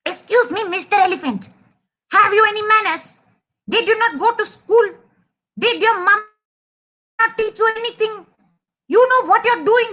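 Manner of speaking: high, indignant little mouse voice; angry mouse. Acted. Angry mouse telling off a messy elephant, high and indignant. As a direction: scold in a high, cross voice. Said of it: "This mouse is very angry".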